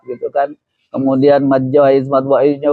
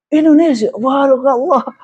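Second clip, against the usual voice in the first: Palestinian man; 9 semitones higher than usual. Retold or imitated. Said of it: "ketemu sama orang Palestina".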